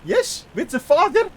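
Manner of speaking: a hint of a mock Dutch-German accent; imitating a bad Dutch accent, lightly; Goldmember Dutch accent. Streamer imitates Mike Myers' Dutch accent lightly. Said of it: "fairly horrendous Dutch accent".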